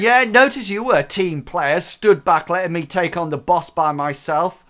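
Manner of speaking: high, loud mocking sarcasm; sarcastic jab. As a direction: sneer at the teammate. Crank mocks teammate.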